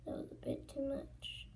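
soft whisper. Real, not acted. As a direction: mutter it under breath. Whispering while doing routine.

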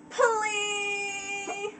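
high, long-drawn whining plea; pleading filly. Acted. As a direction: stretch the word into a childlike whine. Filly begging over the objection.

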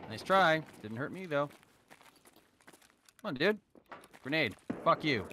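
high, loud cocky taunts; taunting. Real, not acted. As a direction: jeer at the enemy. Taunting enemy after grenade.

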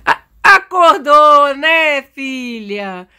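loud, drawn-out wake-up greeting to a daughter; sing-song motherly wake-up call; playful wake-up call. Pretend. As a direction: stretch it out, high and loud. Mother waking her daughter.

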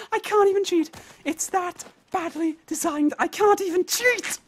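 high-pitched, exasperated wail; high, strung-out disbelief at the game. Real, not acted. Failing despite cheating.

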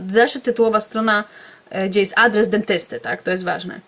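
usual speaking voice

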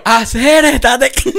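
a hint of mocking drunk friends. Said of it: "Me puse malito y fuera de frío". (voz ligeramente burlona de borrachos)

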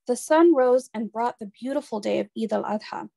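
plain reading voice

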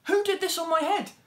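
high and loud eager question; the mole begging the flies for an answer; excited. Acted. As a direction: ask it bursting with eagerness. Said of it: "he asked excitedly".

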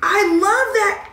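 high, slow, gushing; delighted squeal. Real, not acted. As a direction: sing it out in delight. Delighted by product.

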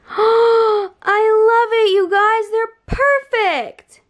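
high, gasping, drawn-out delight. Real, not acted. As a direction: swoon over the perfect bulbs. Lamp reveal delight.

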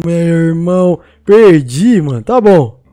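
ordinary talk, nothing marked

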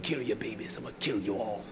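very soft, low menace; hushed threat. Acted. Preacher voicing God's threat to kill, very low and hushed. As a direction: sink almost under the breath to threaten.

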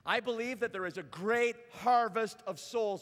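rousing, rhythmic sermon crescendo; impassioned preaching. Real, not acted. Preacher rallying the church to make Jesus known, impassioned preaching. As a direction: build each refrain with conviction. Preaching at full force to standing congregation.

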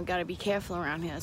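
usual speaking voice

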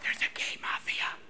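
hushed secret after a long shush; conspiratorial whisper. Acted. Comedian revealing who controls the Oscars, conspiratorial whisper. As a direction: whisper it like forbidden knowledge.

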